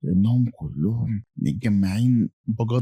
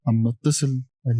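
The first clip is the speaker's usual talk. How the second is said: plain reading voice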